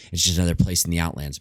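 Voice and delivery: ordinary delivery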